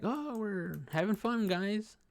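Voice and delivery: high, slow goofy dog voice. Retold or imitated. Dog voiced by the narrator, high and goofy. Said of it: "he's like".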